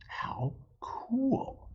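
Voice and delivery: high, unvoiced whisper; excited hushed thought. A narrator's reading. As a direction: breathe it as a secret delight. Amelia's inner thought.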